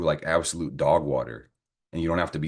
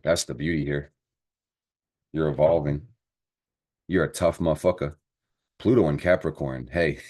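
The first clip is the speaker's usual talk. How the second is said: ordinary talk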